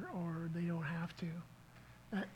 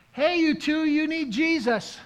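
loud, high call down to the street; shouting out the window; 9 semitones higher, 11 dB louder than usual. Retold or imitated. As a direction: yell it down from a third floor window. Calling out window to fighters.

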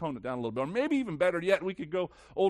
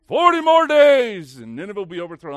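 loud, ringing announcement; shouted proclamation; 6 semitones higher, 15 dB louder than usual. Acted. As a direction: call it out to the crowd. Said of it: "And this is the message?".

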